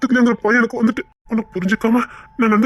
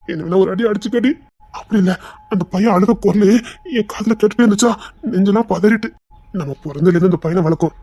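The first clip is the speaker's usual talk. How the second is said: ordinary talk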